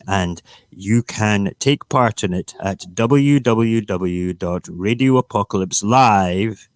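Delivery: loud, emphatic rabble-rouser; hammed-up booming call to arms, stretching key words; mock rallying cry. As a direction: proclaim it like a revolutionary broadcast.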